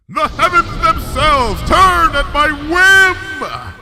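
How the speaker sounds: triumphant high shout, slow; slow, exultant royal shout; exulting. A narrator's reading. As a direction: crow it to the heavens as a princess. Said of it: "exulted Luna".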